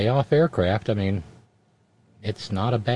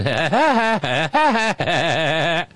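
villain laugh; 3 semitones higher, slower than usual. Retold or imitated. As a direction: cackle like a cartoon villain. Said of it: "funny hat and say".

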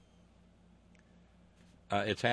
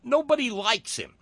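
mocking quote; 14 semitones higher, 8 dB louder than usual. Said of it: "as one of his chief funders has noted".

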